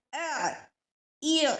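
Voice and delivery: अ ई उ kept thin and light from the throat; don't thicken the sound; light ain demo. Shown as a demonstration. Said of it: "बारीक है, बारीक". (अ ई उ बारीक, हल्की आवाज़ में)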